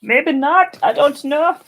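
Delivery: high, loud, squeaky put-on voice. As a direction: squeak it out in a silly voice. Playful doubt about the green.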